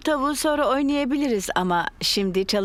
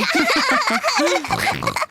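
chuckle; 14 semitones higher than usual. Acted. Mummy Pig laughs at muddy kids.

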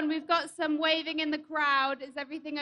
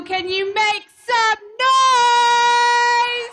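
higher, much louder, very slow sing-song; crowd hype call; 8 semitones higher, 10 dB louder, much slower than usual. Real, not acted. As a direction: stretch it out to rouse the crowd. Rally host hyping the crowd.

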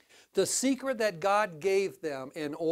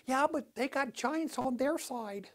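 spies whining; 4 semitones higher, 5 dB quieter, slower than usual. Retold or imitated. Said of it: "And they're going".

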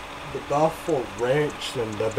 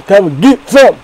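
loud hammed-up challenge; loud taunt; 4 semitones higher, 13 dB louder than usual. Daring the fast food places, loud mock taunt. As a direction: bellow the dare at the burger chains.

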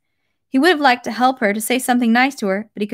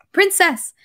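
high, urgent call of a single word; 8 semitones higher than usual. A narrator's reading. Count calling the departing princess back, crying out. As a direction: call after someone to stop them. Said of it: "he cried, trying to stop her".

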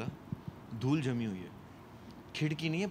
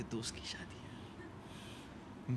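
whisper; 3 semitones lower, 12 dB quieter, much slower than usual. Retold or imitated. Quietly justifying to himself.

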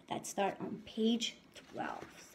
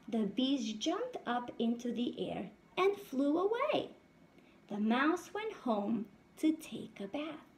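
lively storybook read-aloud voice; reading to children; 3 semitones higher than usual. A narrator's reading. Teacher reading a picture book to her class, lively. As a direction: read it out brightly to the little ones.

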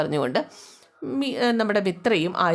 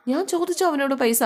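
indignant protest; 7 semitones higher than usual. Acted. Husband protests in quarrel.

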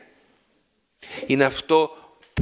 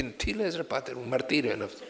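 softer, quick grumble of a tired priest; mock weary priest; 7 dB quieter than usual. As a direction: play the priest moaning about his work, lightly. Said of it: "έλεγε".

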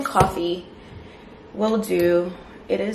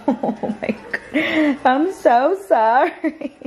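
slow, laughing, higher apology; 7 semitones higher, much slower than usual. Real, not acted. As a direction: laugh through a sorry to the dog.

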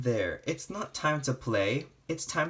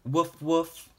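a higher, bright little dog woof read aloud; storybook puppy woof; dog bark; 4 semitones higher, 4 dB louder than usual. Acted. Dog barks in picture book.

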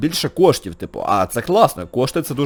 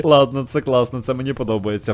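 ordinary talk, nothing marked

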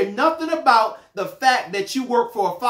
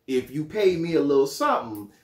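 mock bribable God; 9 semitones lower than usual. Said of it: "God not gonna say".

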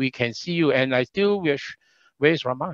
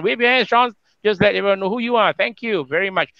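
high, punchy host introduction; MC announcer; 5 semitones higher, 4 dB louder than usual. Real, not acted. Host introducing the youngest candidate, excited MC delivery. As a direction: hype the next guest to the crowd, pitched up.

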